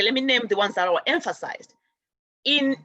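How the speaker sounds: ordinary talk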